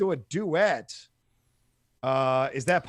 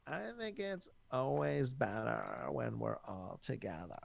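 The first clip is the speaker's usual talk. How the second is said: softer, slow creaky voice; vocal fry; 3 semitones lower, 11 dB quieter than usual. Retold or imitated. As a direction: drag the words in vocal fry. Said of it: "Kim's like / that's a real voice".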